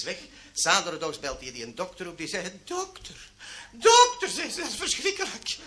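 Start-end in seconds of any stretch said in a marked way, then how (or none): 2.6-3.1 high, hushed mock dismay; dismayed patient. Woman phones doctor in dismay. 3.7-4.3 high, loud mock-distressed cry; alarmed patient. Woman repeats it more urgently. 4.6-5.6 distressed patient. Acted. Distressed woman reporting to doctor.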